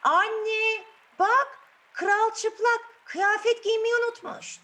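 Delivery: put-on high, loud, slow childish shout; a small boy calling out to his mother. Six-year-old child shouts to his mother: loud, high shout. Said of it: "başlıyor bağırmaya".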